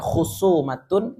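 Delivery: Khusumatun said slowly with Arabic sounds; drawn out, every syllable of Khusumatun; Arabic pronunciation. Shown as a demonstration. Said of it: "jadinya kesumat".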